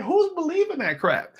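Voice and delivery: high, laughing disbelief; incredulous. Retold or imitated. Husband reacting to the pimp's claim, incredulous. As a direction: scoff at it in a high pitch.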